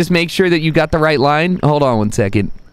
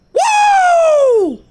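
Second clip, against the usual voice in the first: excited whoop; 5 dB louder than usual. Real, not acted. Cheering golf shot.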